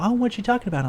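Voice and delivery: high-pitched curious coworker; retold curiosity. Coworker asking about the woman, high retold curiosity. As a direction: lift it high, nosy question. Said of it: "and they're like".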